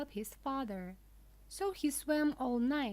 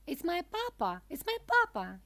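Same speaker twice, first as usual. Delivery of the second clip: high-pitched cry, growing louder; excited cry; 8 semitones higher, 4 dB louder than usual. A narrator's reading. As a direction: call out in recognition, high and rising in force. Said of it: "he cried".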